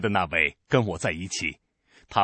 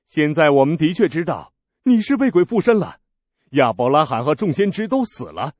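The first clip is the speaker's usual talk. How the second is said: higher and louder, sure of their charge; outraged accusation; 5 semitones higher, 6 dB louder than usual. Acted. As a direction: pounce on his words as proof. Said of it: "那些犹太人说".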